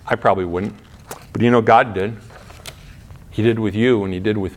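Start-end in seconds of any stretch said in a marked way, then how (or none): none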